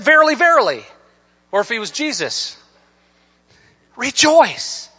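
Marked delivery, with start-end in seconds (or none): 0.0-0.9 VERILY, VERILY high and loud; emphatic. Acted. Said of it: "He emphasized it". 4.0-4.9 REJOICE high and loud; "rejoice" proclaimed high, then falling; emphatic. Acted. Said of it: "He emphasized it".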